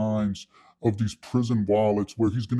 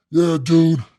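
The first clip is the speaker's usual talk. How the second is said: louder, higher put-on cellmate; agitated cellmate; 4 semitones higher, 5 dB louder than usual. Cellmate complaining on the bus ride, agitated. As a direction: imitate an uncomfortable, worked-up inmate.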